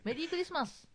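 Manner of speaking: high, soft cute demonstration. Shown as a demonstration. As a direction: show how to say it cutely, small and high. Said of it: "ちょっと可愛い声頑張って". (可愛い声)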